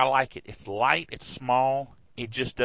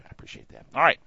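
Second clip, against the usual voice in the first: ordinary talk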